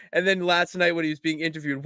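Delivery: normal talking voice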